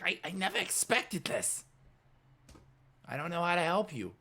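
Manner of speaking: high-pitched, dismayed character voice; helpless Scooter. Acted. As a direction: play a sidekick at a loss, voice high. Scooter at a loss.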